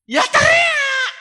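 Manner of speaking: high, slow, crying shout. Acted. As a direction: wail the command out at the top of the voice. Insisting she shout. (teriakan melengking dan lambat)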